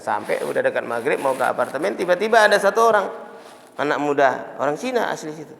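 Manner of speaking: ordinary delivery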